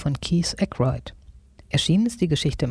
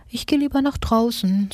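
small high character voice, uneasy; 7 semitones higher than usual. A narrator's reading. Susi retreats from the wobbling machine, mildly nervous. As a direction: back out nervously in a higher voice.

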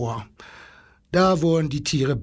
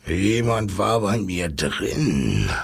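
low, slow lion's rumble; grumbling lion; 7 semitones lower, slower than usual. A narrator's reading. Lion complaining to the zookeeper, in a low grumble. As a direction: grumble slowly, deep down like a big lion. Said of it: "brummte er".

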